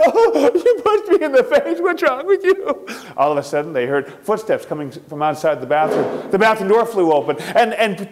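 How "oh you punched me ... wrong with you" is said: high whiny voice, slow at the end; hurt little girl. Acted. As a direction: whimper it out in shock. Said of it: "She said".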